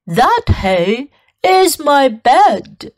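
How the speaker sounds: slow, loud cow voice; a slow, lowing cow. Acted. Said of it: "mooed the cow".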